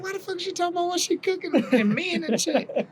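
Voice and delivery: high incredulous outburst; baffled. Retold or imitated. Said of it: "I said".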